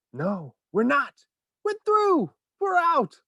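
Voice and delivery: high, loud triumph; triumphant cry. Acted. Wonka bursting through the roof, triumphant. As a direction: crow it in victory. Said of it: "Mister Wonka said".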